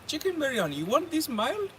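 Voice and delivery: put-on high, questioning Indian accent; imitated Indian accent, pitched well up. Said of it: "I'm gonna do the accent".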